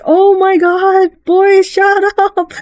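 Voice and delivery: put-on fed up with this boy, drag it out; exasperated mock. Speaker's own exasperated reply.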